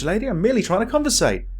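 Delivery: ordinary talk, nothing marked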